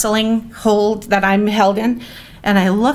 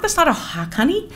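put-on higher, sweet voice for a toddler; gentle mom correction; 7 semitones higher, faster than usual. Mother explaining to her scared toddler, sweetly. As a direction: soothe the little one kindly.

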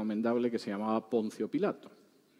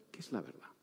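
breathy whisper, dropping almost to nothing; whispered question; 3 semitones lower, 15 dB quieter, faster than usual. Acted. Pilate whispers his doubt to Jesus. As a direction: let the question fade into a bare whisper.

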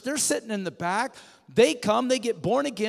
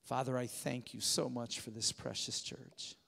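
prayer; 7 semitones lower, 11 dB quieter than usual. Acted. Closing prayer.

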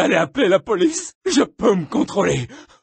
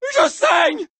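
panicked cry; 13 semitones higher than usual. Acted. Panicking at blood.

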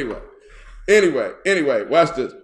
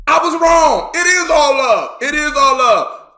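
loud confession; 9 semitones higher, 8 dB louder than usual. Real, not acted.

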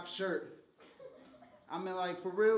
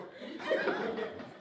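very high airy laugh; a high wheezing laugh; squeaky laugh. Real, not acted. Rapper cracking up after calling rival Chico, squeaky laugh.

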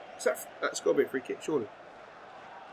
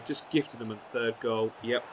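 ordinary talk

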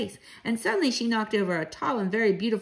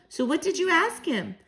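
put-on eager child; 7 semitones higher than usual. Child eagerly questioning Grandmama.

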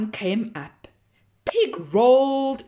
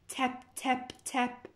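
softly sounded tap tap tap; soft tapping; 3 semitones higher, 8 dB quieter than usual. Acted. Pig hearing tapping in the barn, soft. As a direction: hush it like a distant tap.

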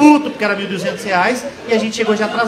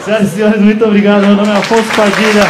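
show sign-off; 5 dB louder than usual. Real, not acted. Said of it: "comedian's closing sign-off".

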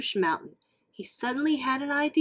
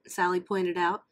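ordinary delivery

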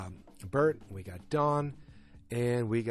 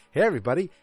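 loud call to the room; announcing; 5 semitones higher, 9 dB louder, much faster than usual. Retold or imitated. Pete announces Harry's arrival to everyone, loud call. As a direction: hail the whole office loudly.

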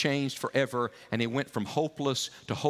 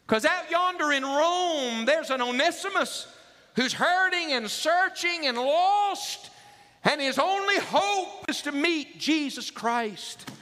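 much higher, slow, soaring plea; impassioned preaching; 14 semitones higher, slower than usual. Real, not acted. Preacher pleading for the lost Onesimus out in Rome, soaring and slow. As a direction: plead with the congregation at full force.

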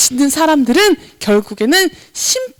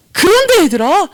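normal talking voice

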